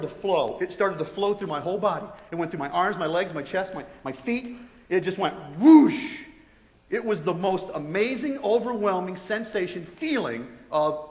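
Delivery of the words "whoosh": drawn-out, forceful rushing noise; loud whoosh. Retold or imitated. Describing love flooding his whole body, loud whoosh. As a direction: imitate a rush, loud and long.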